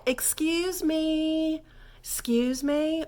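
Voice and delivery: very slow, high excuse me; drawn-out excuse me. Shown as a demonstration. Teacher showing how to get attention politely. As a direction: stretch excuse me to call attention. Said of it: "you would wanna say".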